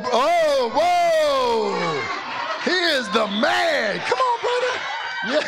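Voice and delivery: laughing, high shouted cheer; excited cheer. Real, not acted. Cheering a birthday boy.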